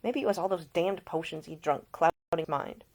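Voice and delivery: ordinary delivery, nothing marked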